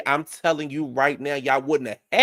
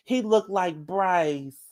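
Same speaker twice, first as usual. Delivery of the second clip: mocking Nene; 4 semitones higher, slower than usual. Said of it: "screaming out the motherfucking window".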